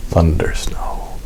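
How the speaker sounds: slow breathy whisper. Real, not acted. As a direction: hush it slowly for effect. Dramatic repeat of thunder snow.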